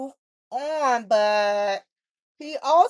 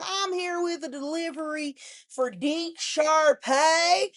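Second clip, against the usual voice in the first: imitating a southern accent; 5 semitones higher than usual. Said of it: "kinda southern accent".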